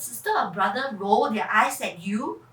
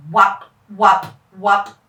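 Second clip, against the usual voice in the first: loud, low rotor chop; helicopter whop; 5 semitones lower, 11 dB louder, slower than usual. Acted. Rotor noise as the helicopter starts, loud and low. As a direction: thump it like blades.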